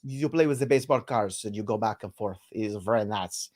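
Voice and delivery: mock French accent. To the co-host about a French card game, in a mock French accent. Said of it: "sounds like something Scooby-Doo would say".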